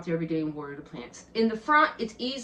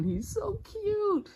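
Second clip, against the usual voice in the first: cooing; 14 semitones higher, 4 dB quieter, much slower than usual. Real, not acted. Admiring the little giraffe.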